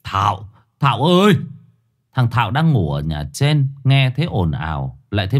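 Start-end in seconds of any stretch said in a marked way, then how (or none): 0.0-1.3 frantic call. A narrator's reading. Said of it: "Ông Thông cuống quýt".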